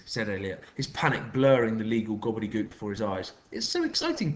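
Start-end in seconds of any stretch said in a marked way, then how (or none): 3.5-4.3 excited shout. A narrator's reading. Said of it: "shouted Patricia".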